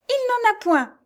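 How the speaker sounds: very high, urgent outcry; crying out. A narrator's reading. Jeanne appeals to her rescuer, crying out. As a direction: cry it out, pitch far up. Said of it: "s'écria Jeanne".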